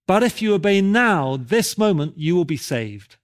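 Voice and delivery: high pulpit warning, settling at the promise; imitated preacher pressing for a decision; exhorting preacher. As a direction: warn emphatically, then ease into assurance. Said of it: "And he went on".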